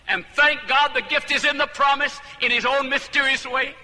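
loud, high, full-force preacher; indignant preaching. Acted.

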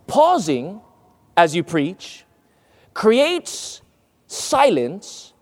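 PAUSING, then a gap, CREATES, a gap, SILENCE; deliberate pauses. Shown as a demonstration. Preacher showing how pausing works, with deliberate gaps. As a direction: break after "pausing", "preach" and "creates", letting each hang. Explains pausing while pausing between phrases.

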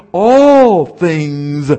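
All punched loud at the start; stress on all. Shown as a demonstration. Preacher stressing All to his congregation, all not most. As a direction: hit the word All hard. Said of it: "The big A L L, not most things".

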